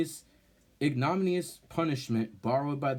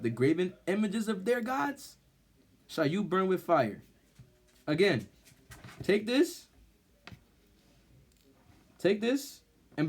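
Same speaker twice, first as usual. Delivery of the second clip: impassioned exhortation; 6 semitones higher than usual. Acted. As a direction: preach it hard, commanding them to burn it. Urges burning the idols.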